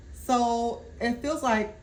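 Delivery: words pushed out through a burp; a burp slips out mid-phrase. Real, not acted. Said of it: "excuse me, I'm burping".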